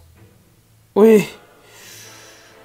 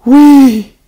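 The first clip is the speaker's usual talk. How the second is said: loud cheer of relief; relieved cheer; 8 semitones higher, 10 dB louder than usual. Real, not acted. Gamer relieved the enemy missed, a loud cheer. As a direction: shout it out with joy.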